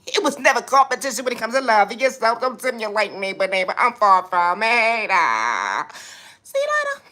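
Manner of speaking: hammed-up high-pitched trash talk; sassy persona voice. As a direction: taunt the commenters in a high cartoon diva voice. Persona voice closing statement.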